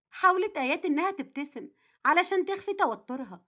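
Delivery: plain reading voice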